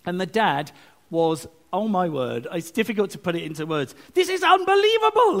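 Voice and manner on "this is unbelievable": much higher and louder, gushing with disbelief; the proud father who can't contain himself, squealing it out; overjoyed dad. Retold or imitated. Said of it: "he was going".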